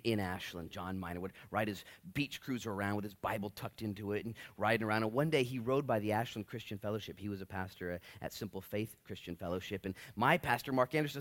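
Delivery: usual speaking voice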